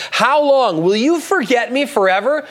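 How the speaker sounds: anguished how long again; questioning lament. Retold or imitated. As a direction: press God with the question. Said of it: "questioning God".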